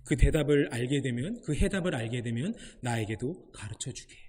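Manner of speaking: God tells Bruce in a low, soft, wry voice to share the answer; God answering gently. Retold or imitated. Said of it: "하나님이 브루스에게 말씀하십니다".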